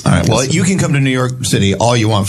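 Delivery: normal talking voice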